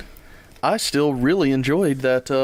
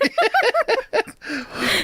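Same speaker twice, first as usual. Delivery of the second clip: high giggle. Real, not acted. Laughs at own mistake.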